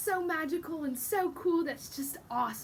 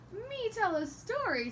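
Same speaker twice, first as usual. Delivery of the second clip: surprised chick; 7 semitones higher than usual. Acted.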